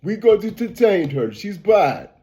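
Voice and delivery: imitating a stern Russian official, lightly accented; lightly voiced Russian official in a mock Russian accent. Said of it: "You know how they got their little Russian accent".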